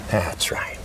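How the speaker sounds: soft approving whisper. Real, not acted. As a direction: breathe it out gently. Hypnotist deepening trance.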